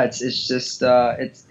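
usual speaking voice

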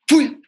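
sudden high, loud whoosh; quick piercing sound of springing up; whoosh noise. Retold or imitated. Dad acting out leaping from bed for daughter, high whoosh.

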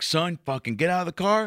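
light mock of a bullying officer; aggressive cop. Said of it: "this cop is like".

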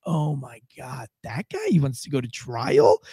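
low, slow retold dismay; mock weary astonishment; exasperated disbelief. Reacting to the affidavit.

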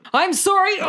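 high, sorry yelp; apologetic yell. Acted. Juniper yelling sorry while stabbing the guard. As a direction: yelp an apology mid-stab. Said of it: "What do you yell as you do it?".

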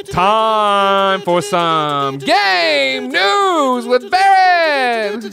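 big drawn-out intro bit; segment intro. Acted. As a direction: draw every word out like a show jingle. Show segment intro jingle.

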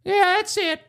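put-on high, sighing old man voice; high-pitched elderly impression, mock gratitude; satisfied old man. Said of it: "He was like".

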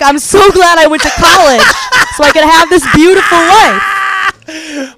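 high, loud, sarcastic gush; mock gratitude. Comic retelling her misery on crutches, mock gratitude. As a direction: stretch out the sarcasm, loudly and high. Bitter quote told while struggling on crutches.

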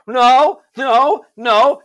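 high, loud panicked refusal; child's frightened no. Retold or imitated. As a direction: shriek no like a scared child. Child refusing new shot.

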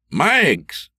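calling through the house, pitch up. Acted. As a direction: call her name into the empty house. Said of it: "he called".

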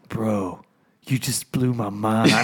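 low, slow, soft stunned voice; deadpan amazement, drawn out; mock awestruck friend. Quoting the pro stunned by his lure, low slow soft. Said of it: "And he goes".

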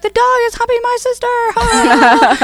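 put-on a kid blurting it out while cracking up; giggly childish telling. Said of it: "I was like".

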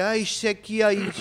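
usual speaking voice

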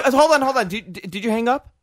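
normal talking voice

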